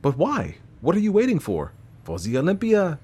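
lightly voiced fussy Belgian detective; Poirot voice.

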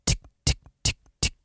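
soft unvoiced ticks, like a reel clicking; fishing line ticking. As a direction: tick it out under your breath. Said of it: "it just goes".

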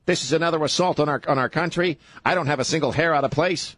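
mock a self-serious TV host; parody pundit.

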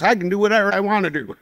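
wife snapping back at her husband, defiant and fast; defiant retort. Retold or imitated. Wife defiantly cursing out God.

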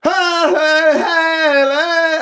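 high, loud ecstatic yelps; speaking in tongues. Retold or imitated. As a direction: mimic a man babbling in tongues. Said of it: "saying in tongues".

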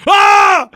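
sudden high, loud "Ah!" scream; imitated shriek, held loud and high; piercing mock scream. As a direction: scream out as if to wreck someone's golf swing. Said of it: "she screams in my backswing".

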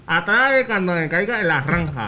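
blurred, open-lipped consonants; garbled mouth-game speech. Real, not acted. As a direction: try the phrase without using your lips. Mouth game, partner guesses distorted phrase.

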